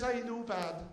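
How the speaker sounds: put-on high-pitched Scottish old lady; Scottish accent. Old lady answering her young visitor, Scottish accent. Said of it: "I apologise for the accent".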